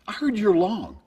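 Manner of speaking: high mocking needle; teasing jab. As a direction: rib him with sarcasm. Payne Stewart teasing all day.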